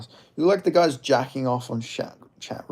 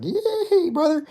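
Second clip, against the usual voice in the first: wailing Southern impression, pitched way up; Bryce Mitchell; 11 semitones higher, 5 dB louder than usual. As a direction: holler a tall tale in an exaggerated country twang. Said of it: "just a redneck".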